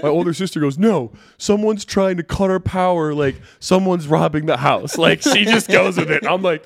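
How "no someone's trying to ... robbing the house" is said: loud, high and drawn-out imitation; mock panic. Older sister convincing everyone of a robbery, mock alarm. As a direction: insist on it slowly, like an alarmed sister.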